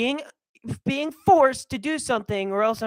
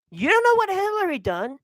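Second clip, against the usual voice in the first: ordinary delivery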